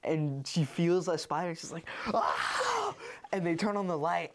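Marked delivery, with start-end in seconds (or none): 2.1-2.9 high-pitched acted scream; sudden high shriek, played for the story; mock scream. Wife feels a spider on her, mock scream.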